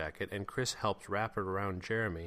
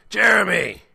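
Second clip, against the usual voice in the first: full-force high shout of a name; bellow; 12 semitones higher, 17 dB louder than usual. A narrator's reading. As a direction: bellow right into someone's face. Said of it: "and bellowed".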